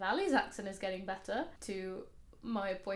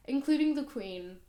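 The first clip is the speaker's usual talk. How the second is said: a hint of the Queen; 5 semitones higher, 5 dB louder than usual. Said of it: "did it with a few different ones".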